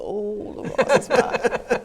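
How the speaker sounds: high, slow, drawn-out delight; delighted exclaim. Retold or imitated. Telling the man [name] is hers, delighted. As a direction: gush over a favourite singer. Excited reply about shared music.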